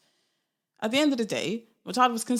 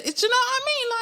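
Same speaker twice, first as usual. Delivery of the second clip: exasperated; faster than usual. Real, not acted. Exasperated aside after list.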